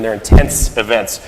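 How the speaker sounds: usual speaking voice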